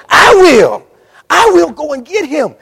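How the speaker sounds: loud, high declaration; God shouting his promise; shouted vow. Pretend. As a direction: proclaim it with full force. God vowing to fetch sheep.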